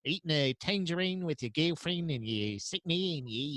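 put-on Australian-accented Alfred rambling on; doing Alfred as an Australian butler. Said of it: "It's an Australian butler?".

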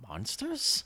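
squeaky high monsters; mock high question. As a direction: pitch monsters way up.

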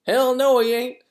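high, loud mock outburst; retold emphatic denial. Rachel denying he is a super senior, imitated loud and high. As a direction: cut in loudly with a high-pitched imitation.

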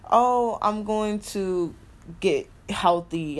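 slow, drawn-out mock resolve. Mocking her own vow to eat healthy, slow and drawn out. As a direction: stretch it out like a promise you won't keep.

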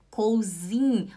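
"Cousin" as kow-ZEEN; wrong spelling pronunciation. Shown as a demonstration. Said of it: "Mas não fala cousin".

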